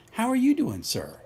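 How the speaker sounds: ordinary talk, nothing marked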